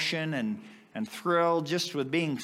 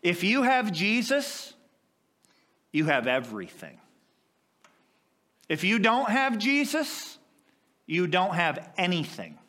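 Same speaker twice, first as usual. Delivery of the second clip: high, ringing pulpit preaching; 5 semitones higher than usual. Acted. Preacher proclaiming to the congregation, ringing.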